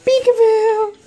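high, loud playful peekaboo; peekaboo singsong. Real, not acted. Playing peekaboo with baby.

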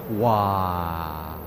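ordinary talk, nothing marked

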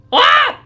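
sudden high gasp of alarm; a startled shriek; shocked high yelp. Real, not acted. Shocked at the message.

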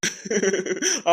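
soft, easy laugh; dismissive chuckle. Acted. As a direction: chuckle softly to brush off worry. Old man brushes off concern.

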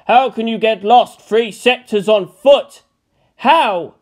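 loud, slow exasperated wail; mock despairing cry. A narrator's reading. Space Marine lamenting to the heavens, loud exasperated wail. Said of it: "he blubbered out to the heavens".

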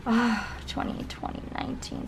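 dreamy whispered year; soft whisper of nostalgia; awed whisper. Real, not acted. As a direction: breathe the year out in wonder. Gushing over last year's planner.

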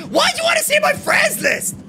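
put-on high shouted kid reply about the friends list; mock high shout. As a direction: squeal back at him in a high yell. Voicing player reply.

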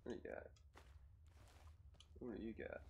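soft muttered question. Real, not acted. Player looting a body, murmuring to himself. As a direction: ask it under the breath.

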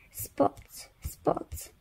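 soft whispered spots; quiet whispered spots. Acted. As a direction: hush it like a secret. Whispered repeated word.

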